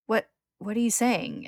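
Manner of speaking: halting, high-pitched disbelief; stunned, drawn-out question. A narrator's reading. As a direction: stammer it slowly, incredulous. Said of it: "with disbelief".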